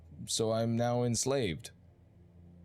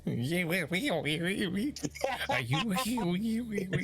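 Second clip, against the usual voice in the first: squeaky mock voice; 8 semitones higher than usual. Mocking the voice mod.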